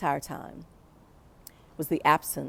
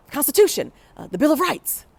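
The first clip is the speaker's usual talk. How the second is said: called out higher and louder; yelled answer; 9 semitones higher, 6 dB louder than usual. Retold or imitated. Students yelling answers to the professor, loud and high. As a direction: class blurting the answer with energy. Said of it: "we yell, we yell out".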